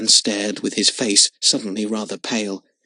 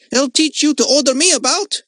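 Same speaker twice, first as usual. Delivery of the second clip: high, louder mocking yell of a bully boy; 14 semitones higher, 5 dB louder than usual. A narrator's reading. Boy yelling at the girl who tells him off. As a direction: jeer it back at her as you run. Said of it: "he yelled".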